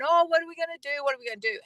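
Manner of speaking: imitating others' panicked fretting; mock freak-out. People around the speaker fretting over the house sale, mock panicked. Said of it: "they're freaking out".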